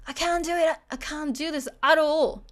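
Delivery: high, forceful "I can't do this at all"; emphatic refusal. Shown as a demonstration. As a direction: stress "at all" as total inability. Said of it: "if you wanna put some emphasis".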